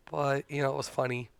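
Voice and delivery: normal talking voice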